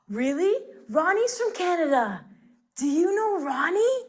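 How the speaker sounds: gasp, then high, eager questioning; hammed-up amazement, pitch climbing at the end; excited surprise. Teacher acting thrilled at meeting a Canadian, excited and high.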